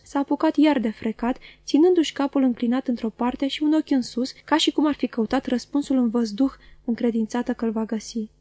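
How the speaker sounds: ordinary delivery, nothing marked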